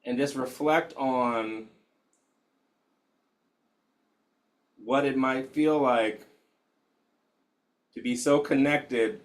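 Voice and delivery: hushed, unhurried guide settling the room; guided meditation. Acted. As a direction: ease them into stillness gently.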